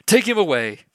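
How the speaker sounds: loud high command; angry command. Acted. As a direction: bark the order. Emperor orders guards.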